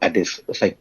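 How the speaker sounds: usual speaking voice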